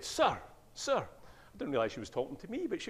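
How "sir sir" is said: schoolgirl calling. Retold or imitated. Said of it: "one of the young ladies in the class said".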